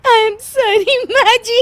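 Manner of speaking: slow, high apology crumbling into laughter; laughing apology. Acted. Daughter-in-law apologising to mother-in-law, laughing through it. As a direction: try to say sorry but laugh.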